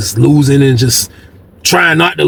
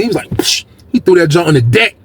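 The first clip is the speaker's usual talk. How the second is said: ordinary talk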